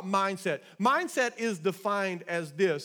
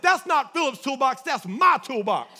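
louder, indignant imitation of an offended wife; mock disdain; 4 semitones higher, 6 dB louder than usual. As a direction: imitate a wife's loud, scornful correction. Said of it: "with so much disdain".

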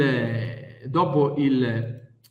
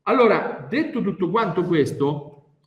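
ordinary talk, nothing marked

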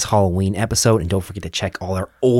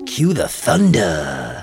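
spooky announcer; much slower than usual. Pretend. Spooky outro cue.

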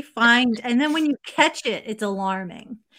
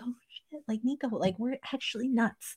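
hushed realization; 10 dB quieter than usual. Retold or imitated. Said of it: "I'm like".

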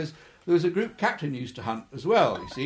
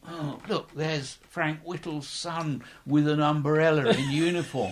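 mocking onlookers; 6 dB louder, slower than usual. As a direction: drawl it out like gossiping mess mates ridiculing him. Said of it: "everybody's going".

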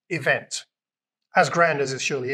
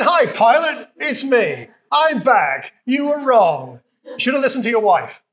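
louder, higher, cocky told-you-so; triumphant gloating; 6 semitones higher, 5 dB louder, slower than usual. Pretend. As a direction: gloat at Pilate like a returning champion. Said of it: "I'd have said".

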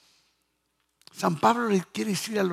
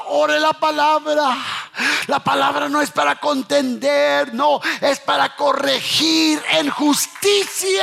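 loud, high preacher's cry; full-force preaching; 6 semitones higher, 5 dB louder, slower than usual. Real, not acted. Preacher urging the congregation to speak the word, fervent shouting. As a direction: drive each phrase home to the congregation.

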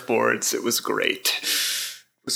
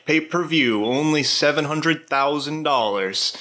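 announcer; 3 semitones higher, slower than usual. Acted. Fight-night announcer hype.

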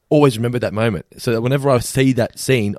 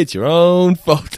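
slow, higher mock character line; slow drawn quote; 6 semitones higher, 4 dB louder, much slower than usual. Retelling a cartoon line, slow and drawn out. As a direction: drag it out slowly.

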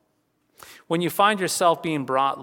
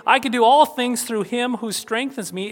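higher and louder, like a proud boast; boastful; 7 semitones higher, 5 dB louder than usual. Shown as a demonstration. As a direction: proclaim it as a bragging claim. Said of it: "is not an arrogant boast".

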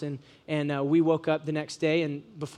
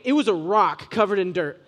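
ordinary talk

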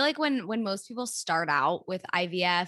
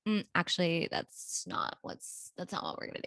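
imitating a hushed correction; 2 semitones lower, 6 dB quieter than usual. Friend imagining Callie correcting the doctor, whispered. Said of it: "to be like".

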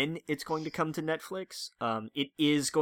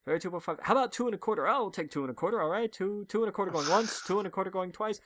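higher, fast auctioneer patter; 5 semitones higher, faster than usual. Pretend. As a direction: rattle off bids like an auctioneer. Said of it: "they're like".

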